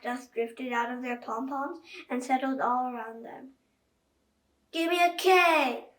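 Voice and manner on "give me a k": higher, louder chant "Give me a K"; pep squad cheer =62; cheerleader shout. A narrator's reading. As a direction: call it out like a cheerleader. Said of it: "shouted the cheerleaders".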